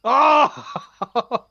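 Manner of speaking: high, loud "oh"; loud exclamation. Real, not acted. Contestant reacting to the quiz answer, loud exclamation. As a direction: cry out in surprise.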